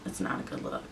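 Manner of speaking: a hushed whispered echo of "It's not a good look"; whispered aside. Shown as a demonstration. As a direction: drop to a whisper for the repeat. Repeats the line, then whispers it.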